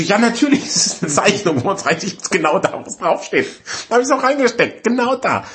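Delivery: high-pitched, exasperated insistence; hammed-up frustrated retort; indignant customer. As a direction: protest high that it was done. Acted indignant answer to hotline.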